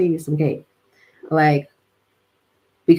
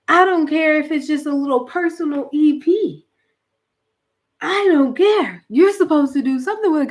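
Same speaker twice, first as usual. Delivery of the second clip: raised, insistent preacher's voice; fired-up preaching; 8 semitones higher, 4 dB louder than usual. Real, not acted. Urging listeners to use their gift, fired up. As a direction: preach it hard to the crowd.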